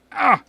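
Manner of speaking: sharp yelp of sudden pain. Real, not acted. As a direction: cry out as fingers get burnt. Just burned fingers on soldering iron.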